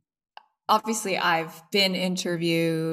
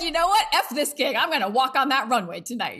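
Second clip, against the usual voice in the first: mock bravado, pitched way up; high, giddy defiance; 6 semitones higher than usual. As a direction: throw the job away, thrilled. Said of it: "I was like".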